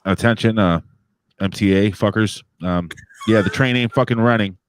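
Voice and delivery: loud, blunt train announcement; imitating a loudspeaker announcement loudly; mock transit announcer. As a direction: call it out like a gruff station announcer. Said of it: "Then you hear, "Attention".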